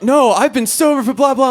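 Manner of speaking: put-on high, agitated drunk-girl protest; mock freaking out. Drunk friend denying she has drugs, mock freak-out. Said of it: "she's freaking out".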